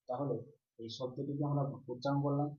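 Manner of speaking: ordinary talk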